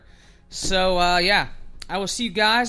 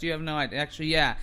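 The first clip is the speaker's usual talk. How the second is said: ordinary talk, nothing marked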